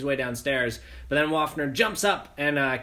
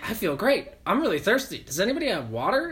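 mock chipper; 7 semitones higher than usual. Revived soldier sounding oddly cheerful.

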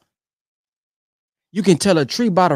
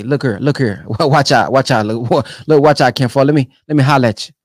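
lower, easy street-corner call, lightly voiced; a hint of a young brother hollering; low, casual street greeting; 6 semitones lower than usual. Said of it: "What's, hey, say".

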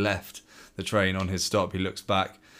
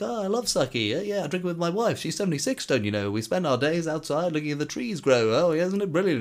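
high, delighted put-on chatter; lilting, enthusiastic old-man voice; little old man; 8 semitones higher than usual. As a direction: play a cheery little old man, pitched way up. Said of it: "the little man's like".

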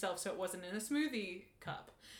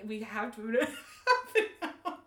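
laughing; 6 dB louder than usual. Retold or imitated. Quoting herself, laughing.

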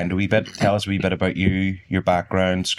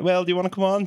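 high quoted voice; 12 semitones higher, faster than usual. Retold or imitated. Retold invitation, high voice.

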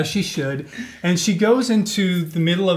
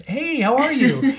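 higher, louder bubbly phone hello; enthusiastic greeting; 10 semitones higher, 5 dB louder than usual. Retold or imitated. Teller acts out greeting a caller she mistakes for a friend, enthusiastic. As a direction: greet a friend with a big upward swoop.

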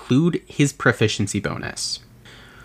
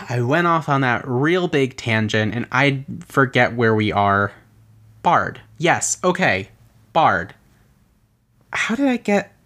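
ordinary delivery

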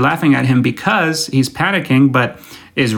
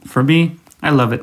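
ordinary delivery

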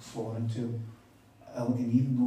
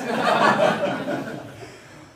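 loud burst of real laughter; big breathy belly laugh; 8 dB louder than usual. Real, not acted. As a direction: burst out laughing, long and hearty. Laughs at his own joke.

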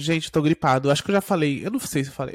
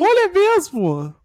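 high, slow, loud exclamation; mock amazement; 12 semitones higher, 7 dB louder, much slower than usual. Host mock-amazed at the topic, loud and high. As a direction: overplay the shock.

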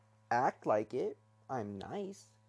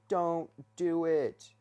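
loud, higher, drawn-out warning; yelling inner voice; 5 semitones higher, 6 dB louder, much slower than usual. Acted. Speaker's own head yelling at them to stop, yelling. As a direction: yell it slowly, stretching each word. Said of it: "yelling at me".

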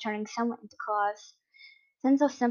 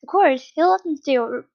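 ordinary delivery

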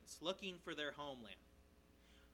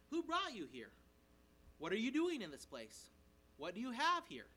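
raised pitch, louder pointed questions; interrogating; 5 semitones higher, 7 dB louder than usual. Acted. Danites questioning the young Levite, interrogating him loudly. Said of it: "they're interrogating him".

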